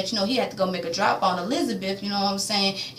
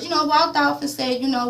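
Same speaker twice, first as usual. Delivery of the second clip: normal talking voice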